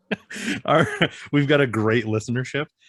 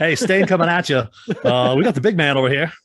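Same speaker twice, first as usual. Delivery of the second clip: loud, high hype-man delivery; Stain character voice; 9 semitones higher, 9 dB louder than usual. Pretend. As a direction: belt it out as a hammed-up radio persona. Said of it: "if I'm staying, I gotta talk like that".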